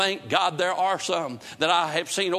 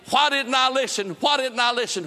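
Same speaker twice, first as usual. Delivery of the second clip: anguished lament; 5 semitones higher than usual. Retold or imitated. Souls in hell cry out in regret, anguished. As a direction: wail it like a lost soul.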